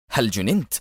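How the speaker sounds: mildly indignant put-on outcry; feigned anger. A narrator's reading. Agent facing a drawn pistol, pretending to be angry. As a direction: act affronted without really raising the voice. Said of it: "هتف به أدهم متصنعا الغضب".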